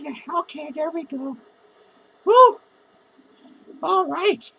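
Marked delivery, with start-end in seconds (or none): 2.3-2.6 high, loud whoop. Real, not acted. Drunk vlogger whooping to viewers, a loud high "woo". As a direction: whoop it up loudly. Whooping while fixing hair.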